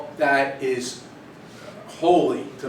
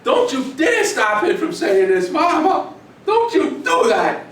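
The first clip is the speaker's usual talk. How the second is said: put-on high, loud, pleading teen yell; daughter yelling at mom; 8 semitones higher, 7 dB louder than usual. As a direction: scream at Mama to let dad talk, half laughing. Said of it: "she starts yelling".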